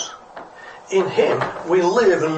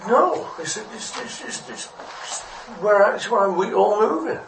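flustered stammering; 7 semitones higher than usual. Acted. Little fish defending itself from rude fish, flustered.

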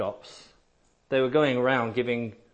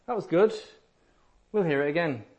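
lightly mocking churchgoer, higher and airy; sing-song, higher, mock polite praise; polite mild interest; 7 semitones higher, faster than usual. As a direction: mimic someone politely unmoved. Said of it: "they'll say".

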